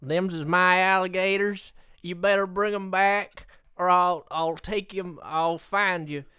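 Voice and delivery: put-on lightly done Southern accent, lawman bluster. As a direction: play a Southern good ol' boy threatening gator thieves. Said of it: "Can you do a southern accent".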